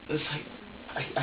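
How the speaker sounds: ordinary delivery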